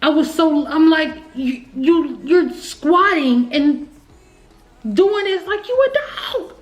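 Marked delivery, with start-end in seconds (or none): 1.3-6.5 retold angry scolding. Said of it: "I was so livid".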